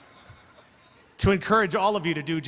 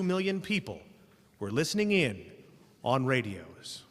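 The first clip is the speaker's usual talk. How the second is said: measured, lower-pitched narration of an ominous prologue; storyteller setting a foreboding scene; dramatic story narration; 3 semitones lower, 3 dB quieter than usual. Acted. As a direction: read it like the grave opening of a radio drama. Host reads the opening narration.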